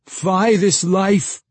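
high, loud, drawn-out theatrical lament; crying out. A narrator's reading. Young man lifting his arms to the full moon, crying out. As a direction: cry it slowly to the heavens. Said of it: "he cried out".

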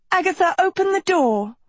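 high, urgent cry to open up; high-pitched desperate demand; panicked plea. Acted. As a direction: plead in alarm, pitched very high. Urgent demand as cat attacks.

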